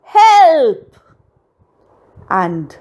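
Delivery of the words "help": high, loud drawn-out cry; full-voiced, high yell; bellowing for help. A narrator's reading. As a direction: bellow it out for rescue. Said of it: "She bellowed".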